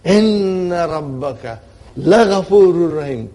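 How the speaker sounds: bang out Inna and Laghafurur like a speaker pounding the table; double emphasis. Shown as a demonstration. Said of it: "if I were to emphasize, I'll say".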